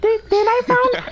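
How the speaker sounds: ordinary talk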